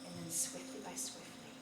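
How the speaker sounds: hushed. A narrator's reading. Narrator hushing the build to midnight, soft and fast. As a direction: lean in and hurry the words low.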